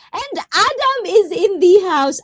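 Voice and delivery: high-pitched playful fanfare for a guest; excited squeal of a welcome announcement; hyped arrival announcement. Pretend.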